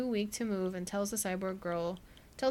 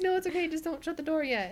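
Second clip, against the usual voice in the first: higher and louder hurried reassurance; mock flustered, pitched up; 8 semitones higher, 4 dB louder than usual. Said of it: "And she's like".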